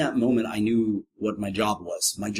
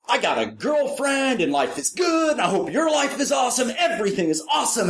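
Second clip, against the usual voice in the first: much higher, shouted, delighted; gleeful shout; 14 semitones higher than usual. Retold or imitated. Former gunman texts the clown his good news, shouting with joy. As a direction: burst out with happy news. Guy's gleeful text message.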